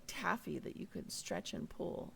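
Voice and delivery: normal talking voice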